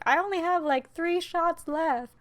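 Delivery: mock tough cop. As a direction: play it as a hammy action-movie cop. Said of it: "she had already said".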